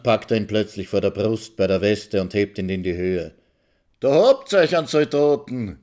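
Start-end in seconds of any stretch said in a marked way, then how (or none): 4.0-5.8 higher and louder shout. A narrator's reading. Maxl lifting Karl up by his vest, shouting. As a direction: shout it out defiantly. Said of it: "schreit Maxl".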